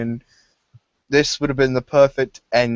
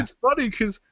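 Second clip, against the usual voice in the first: much higher, lightly voiced quip; cartoon voice; 11 semitones higher, 4 dB quieter than usual. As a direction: squeak it out. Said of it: "he's like".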